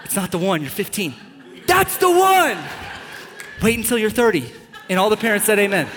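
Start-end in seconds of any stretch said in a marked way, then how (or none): 0.0-1.1 deadpan rebuttal. Acted. Deadpan rebuttal of acted teenager. 1.7-2.6 louder high-pitched, over-the-top infatuation; smitten teenager. Acted. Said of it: "you're like".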